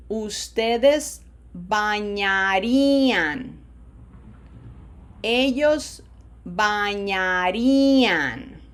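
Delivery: loud, very slow "bañaría" forms with long A's, ny for ñ, single flapped R. Shown as a demonstration. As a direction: stretch "bañaría" syllable by syllable, holding the vowels. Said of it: "long A's, flatter R".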